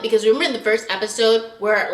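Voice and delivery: normal talking voice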